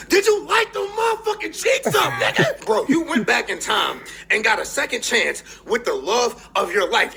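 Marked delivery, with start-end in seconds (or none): 0.1-2.6 high, loud teasing yell; hyped mock shout. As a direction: shout the question like a hyped friend. Falcon's retold question, hyped.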